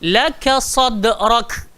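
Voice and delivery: "Laka sadrak" very slow, the d bounced with qalqalah, the k breathed out; model recitation. Shown as a demonstration. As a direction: recite for the class to copy. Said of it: "Apa yang berlaku dekat sini?".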